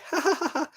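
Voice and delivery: soft, high giggle; self-amused laugh. Real, not acted. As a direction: laugh lightly at your own muddle. Laughs at own brain fog.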